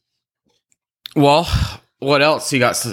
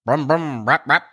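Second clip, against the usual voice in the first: engine noise; 7 semitones higher than usual. Acted. Imitating a dirt bike engine.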